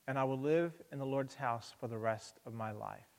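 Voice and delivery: calm, measured psalm reading; scripture reading. Acted. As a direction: read the psalm gently to the congregation. Reads psalm aloud.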